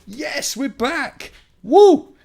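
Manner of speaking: high, slow cheer of relief; excited. Real, not acted. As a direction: whoop with real relief. Stream reconnects after risky reboot.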